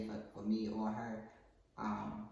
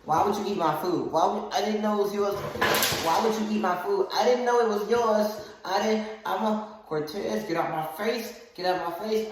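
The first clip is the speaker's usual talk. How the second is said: raised, loud back-and-forth yelling; acted fight; 6 semitones higher, 7 dB louder than usual. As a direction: act out a heated scuffle with your mother. Acting out mother-son fight.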